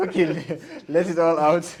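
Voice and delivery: high and loud through laughter; laughing. Real, not acted. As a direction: giggle while urging them on. Host still laughing, urging guest.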